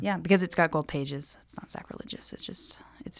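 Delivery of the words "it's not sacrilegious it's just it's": soft unvoiced aside, much quieter; whispered aside. Real, not acted. Whispered aside about the book.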